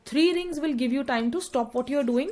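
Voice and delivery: usual speaking voice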